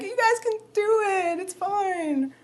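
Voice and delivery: high, teary-sounding actor voice; high reassuring imitation. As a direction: mimic a high, soothing brush-off. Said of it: "But she was like".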